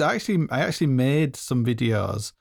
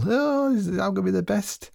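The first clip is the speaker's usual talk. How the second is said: put-on much higher, squeaky, overjoyed child voice; mock cheerful kid thrilled to mine coal; naive kid; 9 semitones higher than usual. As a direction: play the gushing, deluded youngster.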